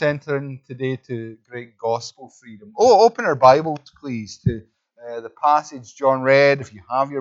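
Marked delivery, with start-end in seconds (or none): none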